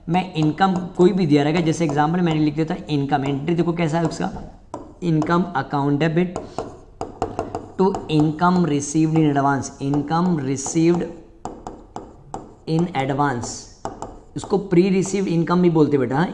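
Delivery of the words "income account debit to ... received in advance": very slow, word-by-word dictation; slow dictation of the entry. Shown as a demonstration. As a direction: spell the entry out for the class. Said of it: "dictates journal entry slowly".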